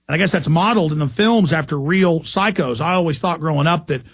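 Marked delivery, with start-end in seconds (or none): none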